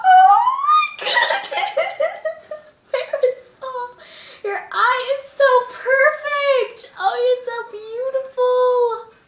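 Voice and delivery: high, drawn-out overjoyed gush; overwhelmed with delight, pitched high; crying and laughing. Real, not acted. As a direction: gush through real tears and laughter. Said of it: "I'm, like, crying and laughing at the same time".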